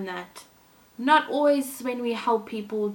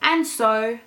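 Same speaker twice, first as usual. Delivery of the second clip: gentle storybook reading voice; storyteller reading a fairy tale to kids; story narration; 2 semitones higher, 4 dB louder than usual. A narrator's reading. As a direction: read it like a bedtime story for children. Reads Thumbelina story aloud.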